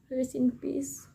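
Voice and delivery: soft slow solemn aside; hushed mock mourning. Real, not acted. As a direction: murmur it like a eulogy. Reader mourns character.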